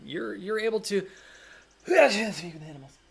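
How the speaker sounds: high, loud grand proclamation; dramatic announcement. Acted. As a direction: announce it like a triumph. GM announces spell success.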